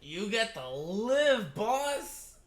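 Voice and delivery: high, loud and slow, stretched-out cheer; excited cheer. Real, not acted. Viewer cheering that the boss gets to live, loud and high. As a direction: celebrate loudly at the screen. Cheering a character being spared.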